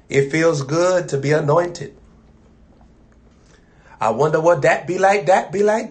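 normal talking voice

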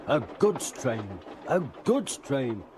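building indignant grumble; grumbling. A narrator's reading. Gordon grumbling about pulling dirty trucks, building grumble. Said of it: "he grumbled".

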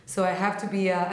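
usual speaking voice

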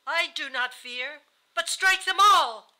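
high, very loud delirious shout; frightened cry. A narrator's reading. Delirious dying wife crying out in fright, frantic. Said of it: "She was frightened and cried".

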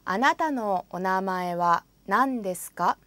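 ordinary talk, nothing marked

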